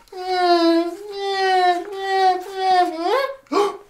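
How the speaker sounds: high, loud shocked gasp; big dramatic gasp. Acted. Reading to a child, gasp at the crash. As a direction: gasp as if the plane just crashed.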